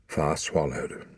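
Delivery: ordinary delivery, nothing marked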